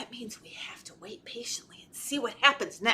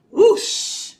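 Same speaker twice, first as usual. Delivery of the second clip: one loud, high whoosh; sudden rush of giant wings; whoosh sound; 9 semitones higher, 10 dB louder than usual. A narrator's reading. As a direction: imitate a big swoosh. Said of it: "He heard the sound again".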